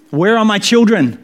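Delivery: put-on high, loud cry for his children; desperate cry. A narrator's reading. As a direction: call into the dark, frantic. Damned man calling for family.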